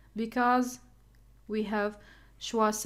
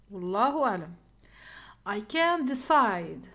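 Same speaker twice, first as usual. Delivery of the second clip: ordinary talk, nothing marked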